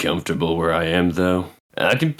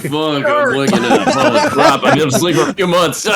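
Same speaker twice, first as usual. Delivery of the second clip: excited yelling; 12 semitones higher, 6 dB louder than usual. Real, not acted. Drunk player shouting at screen.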